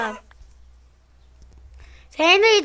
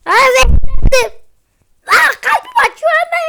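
high, loud, hammed-up poisoned wail; cartoonish fake death panic; mock dying cry; 8 semitones higher, 10 dB louder than usual. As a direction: overact being poisoned, crying out to mom.

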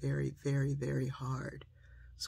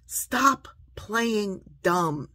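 loud, raised, very slow admonition; emphatic word-by-word scolding; forceful rebuke; 6 semitones higher, 9 dB louder, much slower than usual. Real, not acted. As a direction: punch out each word separately. Rebukes the mocked excuse-makers.

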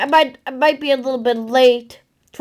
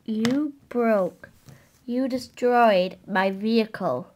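low, hushed disbelief; stunned; 7 semitones lower, 8 dB quieter than usual. Acted. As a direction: say it softly, in shock. Stunned driver after crash.

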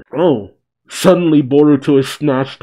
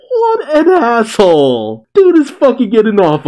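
laughing outrage; 10 semitones higher, 5 dB louder than usual. Real, not acted. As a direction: laugh it out in disbelief.

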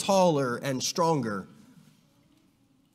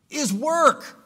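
"work" pitched higher and drawn out; stress on work; 7 semitones higher than usual. Shown as a demonstration. Teacher to class, driving home that eldership is "work". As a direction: lift and hold "work". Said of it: "Let's emphasize that word".

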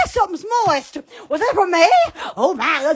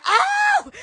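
high-pitched long "oh"; startled high holler; high squeal; 13 semitones higher than usual. Real, not acted. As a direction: squeal upward and hold. Squealing reaction.

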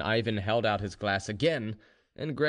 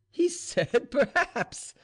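high, slow hooting mockery through laughter; laughing loudly; 4 dB louder, much slower than usual. A narrator's reading. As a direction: repeat it incredulously, very high, laughing. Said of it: "laughing louder than ever".

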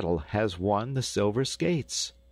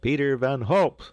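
loud, raised announcement of the winner; higher, louder proclaiming call; crier's shout; 6 semitones higher, 6 dB louder than usual. A narrator's reading. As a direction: shout the name out like a town crier. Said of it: "shouted the crier".

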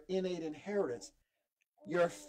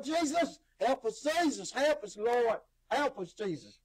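raised, fervent plea easing at the end; calling on Jesus; 5 semitones higher, 5 dB louder than usual. Real, not acted. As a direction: cry out to heaven for help. Calls on Jesus mid-sermon.

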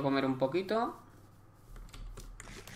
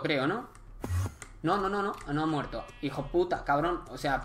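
usual speaking voice

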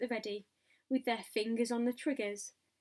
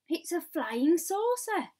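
high, loud, drawn-out cry; excited crowd shout; 7 semitones higher, 7 dB louder, slower than usual. A narrator's reading. Crowd shouting at the sight of a flying saucer, excited shout. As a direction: shout it slowly so the whole street hears. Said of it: "they shouted".

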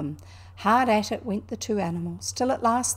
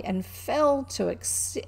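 plain reading voice

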